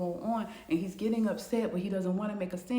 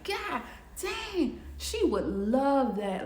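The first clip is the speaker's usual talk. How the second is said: surprised realization; 6 semitones higher, much slower than usual. Retold or imitated. Husband realizes wife would love offer.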